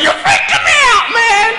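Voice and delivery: very high, loud shrieking yell; freaked-out girl. Retold or imitated. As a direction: shriek it like a spooked girl at the lights. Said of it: "she's like".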